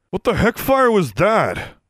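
high, slow annoyed demand. A narrator's reading. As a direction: demand it, stretched and irritated. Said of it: "demanded Vance".

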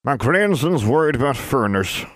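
put-on high, twangy old crone; old witch voice. A narrator's reading. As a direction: speak like a cranky old witch.

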